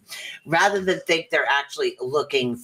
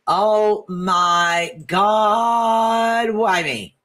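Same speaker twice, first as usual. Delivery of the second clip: drawn-out loud wail; mock despair; 5 semitones higher, 3 dB louder, much slower than usual. Complaining about life, hammed-up loud wail. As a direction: wail it like melodrama. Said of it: "And some of it's just like".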